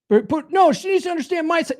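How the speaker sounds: high, rushed whiny objection; hammed-up pleading pushback; mock protest. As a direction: imitate a husband insisting on his side. Mimics an objecting husband's protest.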